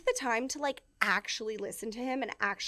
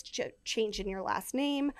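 usual speaking voice

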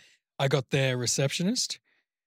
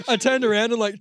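ordinary talk

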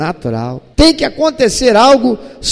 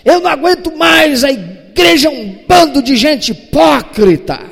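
loud, higher self-righteous griping; whiny complaint; 7 semitones higher, 4 dB louder than usual. Retold or imitated. Elias complaining to God about the church, loud and self-pitying. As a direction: vent like a bitter churchgoer. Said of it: "igual a muita gente religiosa costuma fazer".

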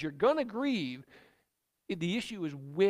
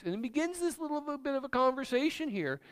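ordinary talk

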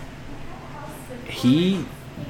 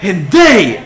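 high, loud proclamation; accusing yell; 7 semitones higher, 9 dB louder than usual. Acted. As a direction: yell it out to the crowd. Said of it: "she yells out to them".

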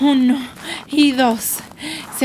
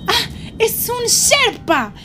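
high, gasping recognition; excited discovery; 9 semitones higher, slower than usual. Pretend. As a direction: break off, gasp, then announce it with delight. Spots a rescuer approaching. (emoción al descubrirlo)

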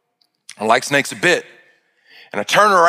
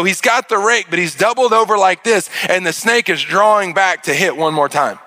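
ordinary talk, nothing marked

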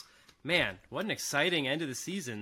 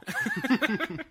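short high laugh; high chuckle; 4 dB louder than usual. Real, not acted. Laughing at the question.

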